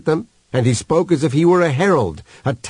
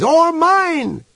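very high, urgent cry. A narrator's reading. Other piggies echo Human's plea, crying out. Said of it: "cried the other piggies".

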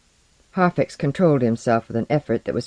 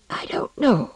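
high, whispery feeble voice; frail, high, breathy denial; weak and tearful; 8 semitones higher than usual. A narrator's reading. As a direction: whimper it with hardly any breath. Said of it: "sobbing with weakness".

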